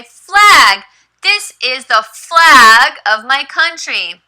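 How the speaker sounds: high, slow classroom reading; teacher voice. Shown as a demonstration. Teacher teaches her student the word flag, high and slow. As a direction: point out the word flag brightly.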